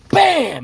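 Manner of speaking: sharp high shouted bam for the door kick; sudden loud impact cry. Retold or imitated. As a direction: slam the sound out. Door kicked in.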